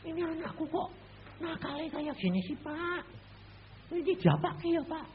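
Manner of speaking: high-pitched mocking mother's voice; mother's complaint. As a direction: imitate a worried mother, up high. Mother complains about child. (suara ibu yang mengeluh, tinggi)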